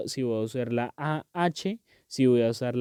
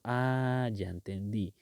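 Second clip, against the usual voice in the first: soft, lower "Ah, ya entendí"; understanding ah; 4 semitones lower, 5 dB quieter, slower than usual. Shown as a demonstration. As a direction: let it drop softly as it clicks. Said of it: "uno sí sabe que está diciendo".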